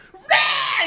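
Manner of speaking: high, loud hammed-up heave; loud retching yell. Boss puking into the planter again, mock retch. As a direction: retch it out, half laughing. Imitating man vomiting again.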